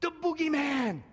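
high-pitched cry of alarm; frightened little sister. Retold or imitated. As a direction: imitate a scared little girl wailing. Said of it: "my sister begins to cry".

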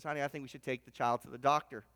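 a mother's worried suggestion, voice dropped low; low hurried worry to her husband; worried mom. Acted.

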